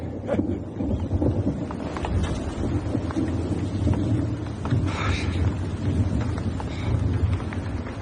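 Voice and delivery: long, quiet, breathless laugh; long wheezing laugh. Acted. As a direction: laugh it off nervously after the joke. Long laugh after joking.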